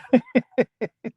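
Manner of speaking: real amused laugh. Real, not acted. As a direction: burst out laughing. Amused by a viewer's name.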